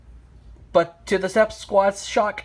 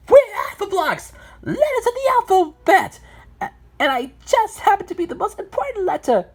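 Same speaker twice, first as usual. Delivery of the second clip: very high cartoon voice, showing off; self-important letter character; 13 semitones higher than usual. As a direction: introduce yourself proudly in a high squeaky voice. Self-important letter introduces the blocks.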